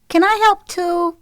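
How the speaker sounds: very high, eager little voice; eager child. A narrator's reading. Madison asking to help with the horse, eager child. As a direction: plead brightly like a keen kid. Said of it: "Madison asked eagerly".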